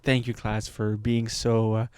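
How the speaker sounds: a hint of the hurt teacher; soft teacher thanks. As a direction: thank the class softly. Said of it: "she said".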